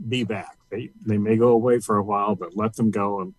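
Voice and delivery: ordinary talk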